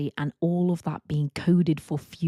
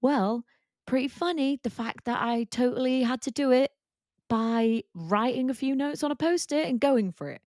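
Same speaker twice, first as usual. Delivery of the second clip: airy, higher voice, shrugging it off; breezy self-quote; 7 semitones higher than usual. Retold or imitated. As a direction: play it cool and amused. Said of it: "I'm like".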